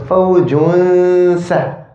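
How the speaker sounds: fawjun sa'a drawn out, noon hidden and nasal; slow demo with gunna. Shown as a demonstration. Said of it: "the sweet spot".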